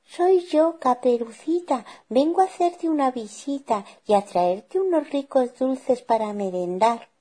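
put-on very high child voice; high-pitched little granddaughter; little girl. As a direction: announce yourself cheerily as a small girl. Girl announces herself at the door.

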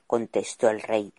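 plain reading voice

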